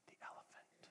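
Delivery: very faint, hushed whisper. Real, not acted. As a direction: whisper it like an unspoken secret. Whispers the series title to close.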